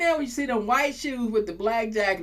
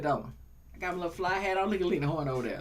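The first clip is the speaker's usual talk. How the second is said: ordinary delivery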